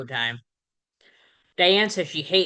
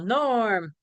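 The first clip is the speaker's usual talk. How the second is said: imitated yell of welcome; mild mock yell; 5 semitones higher than usual. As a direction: draw it out like bar regulars greeting a friend. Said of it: "They yell".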